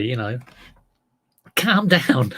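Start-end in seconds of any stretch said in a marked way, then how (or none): 1.6-2.3 Scouse accent. Shown as a demonstration. Demonstrating the typical Liverpudlian catchphrase, mock Scouse accent. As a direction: put on a hammed-up Scouse accent. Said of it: "typical thing to say if you're Scouse".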